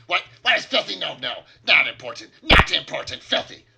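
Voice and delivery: loud, high goblin scoffing; scornful goblin. Acted. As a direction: sneer it loudly like a goblin.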